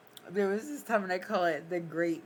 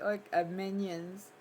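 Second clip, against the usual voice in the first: usual speaking voice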